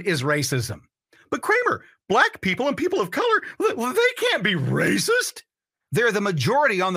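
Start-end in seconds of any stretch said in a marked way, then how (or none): 1.3-5.4 high-pitched, whiny-protest caricature of an objector; mock objector. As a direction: put on a much higher, indignant objector's voice. Mocking imagined objector's protest.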